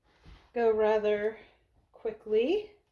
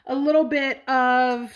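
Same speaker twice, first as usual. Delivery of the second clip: talking while chewing gum; words round a wad of gum; 3 dB louder than usual. Real, not acted. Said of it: "I can't talk and chew gum at the same time".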